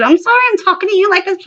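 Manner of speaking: high, rushed retold apology; re-enacted hysterical jabber; incoherent upset babble. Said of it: "saying incoherent stuff".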